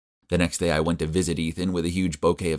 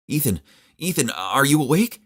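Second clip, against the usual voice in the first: higher, eager calling; hopeful call; 8 semitones higher than usual. A narrator's reading. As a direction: call him gently but eagerly. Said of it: "calling to waking patient".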